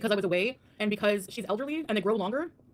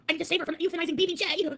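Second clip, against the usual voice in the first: high-pitched mocking imitation; mock-dramatic rescuer; 10 semitones higher, 3 dB louder than usual. Mocking a rival who claims to save the cat, mock-dramatic. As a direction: put on an overblown, high heroic plea.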